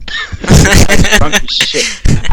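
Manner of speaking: put-on loud, very slow slurred drunk voice; drunk guy voice. Mimicking the drunk man at the door, a loud slurred drawl. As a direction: ham up a sloppy drunk.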